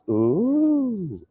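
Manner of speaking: high, impressed swooping ooh. Retold or imitated. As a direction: coo it like you're impressed. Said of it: "they'll say".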